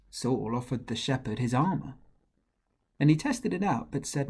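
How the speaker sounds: plain reading voice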